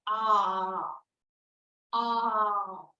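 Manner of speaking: long open ah; doctor's ah. Shown as a demonstration. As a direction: open wide and say ah. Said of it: "doctor is putting a".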